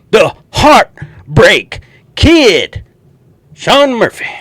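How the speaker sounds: drawn-out, high, loud wrestling intro; announcing a wrestler's entrance, slow and booming; ring announcer. Pretend. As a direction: stretch it out like a ring announcer hyping the crowd.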